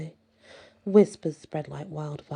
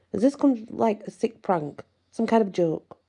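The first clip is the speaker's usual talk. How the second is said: higher-pitched, incredulous questioning; halting, disbelieving challenge to a suspected prank; skeptical officer; 5 semitones higher, 3 dB louder than usual. A narrator's reading. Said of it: "met Jason's plea with skepticism".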